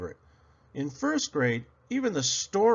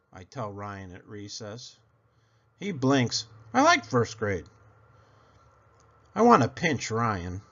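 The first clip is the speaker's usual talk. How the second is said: plain reading voice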